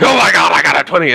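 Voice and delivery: high, loud thrilled shout over a natural twenty; excited yell. Retold or imitated. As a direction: burst out like you just rolled a crit. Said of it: "I'm sorry if that was really loud".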